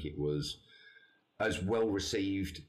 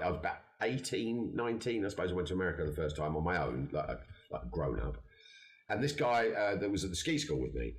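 usual speaking voice

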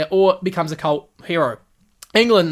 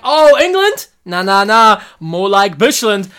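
mock taunt; 3 semitones higher, 7 dB louder, slower than usual. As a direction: taunt England playfully. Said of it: "he's like".

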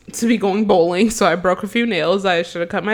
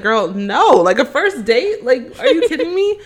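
incredulous protest; 6 semitones higher, 4 dB louder than usual. Retold or imitated. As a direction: push back, higher and higher, appalled. Mock disbelief at sister's excuse.

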